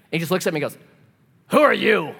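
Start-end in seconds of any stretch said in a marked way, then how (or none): 1.5-2.1 loud, higher demand in the neighbor's voice; acted suspicious outburst; hostile challenge. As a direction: imitate a mean man confronting a stranger. Acted mean neighbor challenging visitor.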